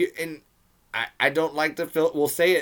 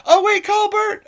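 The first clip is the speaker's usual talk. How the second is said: very high, loud cry for help; mock panicked shout; 10 dB louder than usual. Swooped walker calling for Bert, mock panicked high shout. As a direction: yell it in hammed-up panic.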